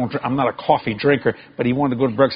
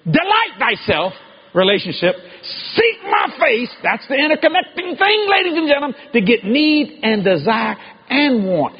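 full-force preaching; 10 semitones higher than usual. Acted. Preacher crying out to the congregation, full-force preaching. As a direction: preach it out to the whole hall, rising to a shout.

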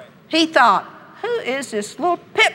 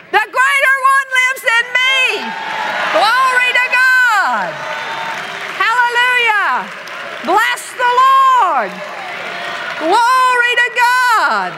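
preaching shout; 10 semitones higher than usual. Acted. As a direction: shout praise to the rafters. Preaching at full force.

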